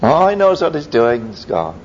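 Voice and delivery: normal talking voice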